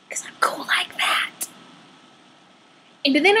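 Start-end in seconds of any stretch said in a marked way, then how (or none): 0.1-1.5 soft unvoiced whisper to the camera; a proud little whisper; whispered aside. Real, not acted. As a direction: let it slip out as a secret boast. Smug aside to viewers.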